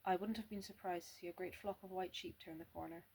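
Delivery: usual speaking voice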